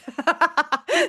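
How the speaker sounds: a short, high laugh at herself; laughing off the story; real laugh. Real, not acted. Amused at her own tale told to viewers, high laugh.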